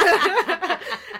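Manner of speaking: squealing burst of laughter; loud high giggle. Real, not acted. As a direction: lose it laughing. Laughing fit.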